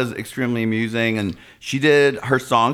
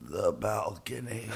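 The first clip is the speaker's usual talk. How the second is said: deadpan, far softer and slower; punchline, much softer; 3 semitones lower, 13 dB quieter, slower than usual. Retold or imitated. Man in the seats answering the usher, much softer. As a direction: drop it low and quiet as the punchline. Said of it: "he looks up and goes".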